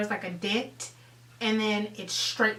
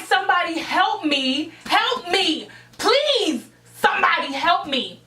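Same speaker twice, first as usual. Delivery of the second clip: loud, higher cries for help, acted out; retold plea for help; 7 semitones higher, 5 dB louder than usual. Girl in the pushed basket calling to anyone, panicked retold plea.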